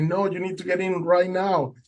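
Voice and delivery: high-pitched urgent command, imitated; urgent order. Boatman ordering the tired swimmer aboard, urgent retold command. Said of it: "the guy starts screaming".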